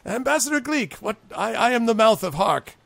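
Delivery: high, halting official introduction; flustered high-pitched goblin dignitary; self-important goblin ambassador. Acted. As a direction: recover your composure and announce yourself pompously. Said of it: "He's like".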